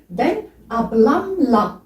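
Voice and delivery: slow Turkish "ben ablamla", long final a; Turkish demo. Shown as a demonstration. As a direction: stretch "ablamla" out clearly. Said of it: "on a mis".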